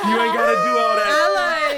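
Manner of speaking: high shriek of laughter, then loud; loud high laughing protest to dad. Real, not acted. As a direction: cackle then shout it out slowly. Teasing dad's limp wrist.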